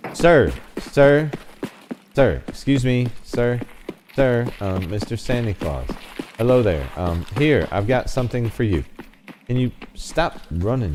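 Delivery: loud, high polite calls; calling out. Real, not acted. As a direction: call after him, faking politeness. Calling fleeing Santa.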